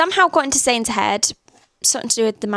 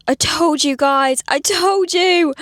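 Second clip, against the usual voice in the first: slow, loud, higher told-you-so; excited told-you-so; 5 semitones higher, 4 dB louder, slower than usual. Real, not acted. Reader reacting to book twist, loud gloating. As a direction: gloat to viewers, drawn out. Reader vindicated about creepy Marsh.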